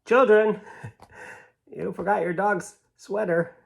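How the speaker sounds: high, polite put-on voice of a helpful stranger; polite man. Helpful man calling to the children, high and polite. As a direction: play a fussy polite gentleman returning the sweater. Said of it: "This man's like".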